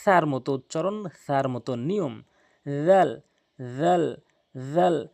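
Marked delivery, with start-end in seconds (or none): 2.9-5.1 জাল said loud and firm, tongue tip on the tooth edges; Arabic dhal. Shown as a demonstration. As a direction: punch জাল out clearly. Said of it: "সাটি জালের মতো".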